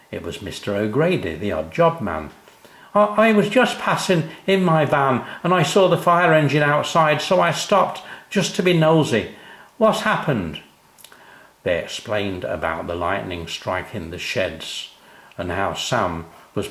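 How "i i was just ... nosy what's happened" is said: put-on a cheery old workman breezing in; kindly odd job man. O'Grady finds the crying family, cheerful and high-pitched.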